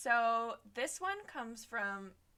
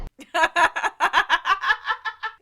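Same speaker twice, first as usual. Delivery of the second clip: high, very loud burst of laughing; real uproarious cackling laugh; loud laughter; 10 semitones higher, 17 dB louder than usual. Real, not acted. Host cracking up at "no comment", loud high laughter.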